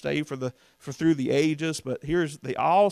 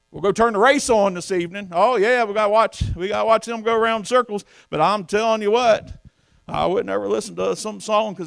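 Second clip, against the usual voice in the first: loud, higher preacher's mocking jab; preaching at full force; 5 semitones higher, 6 dB louder than usual. Real, not acted. Preacher to preachers on watching the race, loud mockery. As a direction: raise the pitch and hammer it home.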